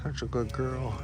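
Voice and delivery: soft praise. Real, not acted. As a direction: coo it quietly to the ewe. Praising her sheep softly.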